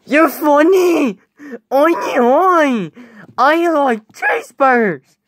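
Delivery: high, slow goofy voice; drawn-out, high-pitched silly talk; talking funny. Acted. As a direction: blurt it like a brain-addled goofball. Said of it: "he's now talking funny".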